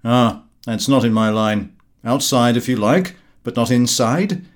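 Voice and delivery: loud, cocky interrupting friend; a pushy busybody brushing the idea off; Gowing's brash voice. Acted. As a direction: blurt it out like a tactless guest.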